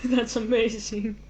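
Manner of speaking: usual speaking voice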